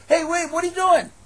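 loud, high startled cry; alarmed shout. Acted. King shouting as the tailor cuts his suit, alarmed. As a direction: yelp as the scissors cut.

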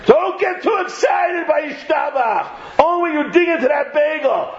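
high-pitched, emphatic mock scolding; mock exhortation. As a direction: call out a ridiculous rule with force. Mock rule about excitement.

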